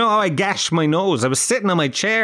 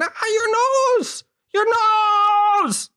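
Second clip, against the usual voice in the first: very high-pitched, drawn-out shriek; mock screaming; slower than usual. Imitating Kira screaming at the bloody nose, high mock shriek. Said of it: "Kira screaming".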